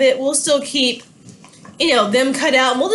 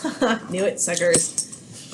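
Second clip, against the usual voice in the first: quieter, lower smug taunt; mock gloat; 7 semitones lower, 7 dB quieter, slower than usual. Napoleon quietly gloats as Britain declares war. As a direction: snicker at them under your breath. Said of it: "Napoleon goes".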